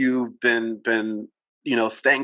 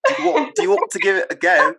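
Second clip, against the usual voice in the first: higher, put-on British lilt; attempted British accent; 5 semitones higher, 3 dB louder than usual. Shown as a demonstration. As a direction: copy a British speaker's accent. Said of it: "That's not too bad".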